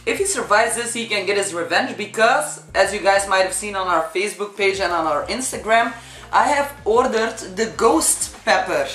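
usual speaking voice

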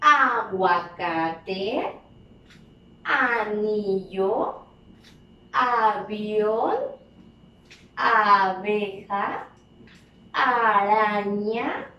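cada palabra muy despacio y marcada; léelas despacito para que los niños repitan; lectura lenta en voz alta. Shown as a demonstration. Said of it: "Vamos a repetir las palabras en voz alta". (lectura pausada y silabeada de maestra)

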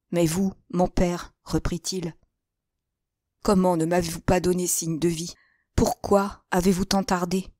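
plain reading voice

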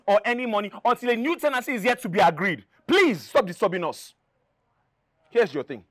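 high, loud, furious confrontation; angry. Acted. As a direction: lay into her, outraged. Tenant angrily confronting landlady.

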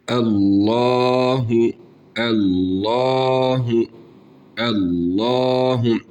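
Allahu with a full thick l, the a held one elif; thick recitation. Shown as a demonstration. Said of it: "kalın bir şekilde okuyoruz". (Allahu kalın ve bir elif uzatarak)

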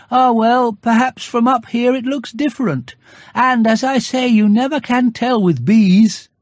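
high, gentle bear voice, hopeful; a little bear floating on a balloon, reassuring himself; Pooh Bear voice. A narrator's reading.

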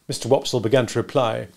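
ordinary delivery

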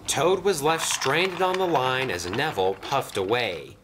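usual speaking voice

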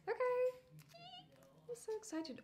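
high, loud burst of excitement; excited. Real, not acted. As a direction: squeal with real excitement.

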